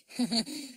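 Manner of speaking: short, high chuckle; brief joining-in laugh; character laugh. A narrator's reading. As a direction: laugh along in a high little voice. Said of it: "And Noddy laughed too".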